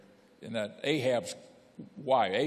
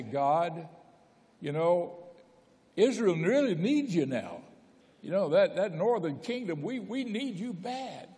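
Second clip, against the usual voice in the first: raised, earnest pleading to God; pleading prayer; 6 semitones higher than usual. Retold or imitated. Preacher voicing Elijah begging God, raised pleading. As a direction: beg God to show up, pitched up.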